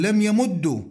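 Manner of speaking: "يمد" ending in "u", yamuddu; damma ending. Shown as a demonstration. Teacher showing the vowel that follows the root's, "يمد" ending in damma. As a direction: close "يمد" on a doubled d plus "u". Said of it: "الضم يجوز تبعا لضمة العين".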